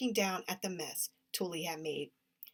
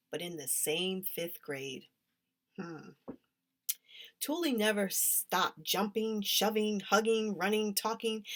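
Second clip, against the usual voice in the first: ordinary delivery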